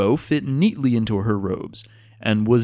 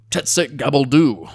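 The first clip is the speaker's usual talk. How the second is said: called out; 4 semitones higher, 6 dB louder than usual. A narrator's reading. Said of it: "called Nutmeg".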